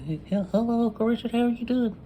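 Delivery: put-on high, childlike hello; little kid greeting. Young boy greeting his new uncle in a high child voice. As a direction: voice yourself as a wary small boy. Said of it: "I'm looking".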